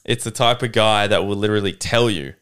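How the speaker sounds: normal talking voice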